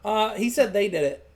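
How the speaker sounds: ordinary talk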